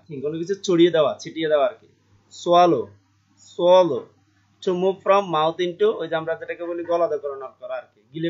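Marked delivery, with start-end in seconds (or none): 2.4-4.0 loud model word. Shown as a demonstration. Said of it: "model pronunciation loudly". 4.6-5.9 slow reading. Shown as a demonstration. Teacher reads definition slowly.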